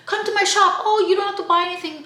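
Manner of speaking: high, fast salesman pitch; mock the shouting sellers; pushy shopkeeper. Imitating a pushy souk shopkeeper calling her in.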